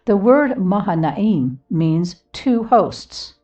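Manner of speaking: ordinary talk, nothing marked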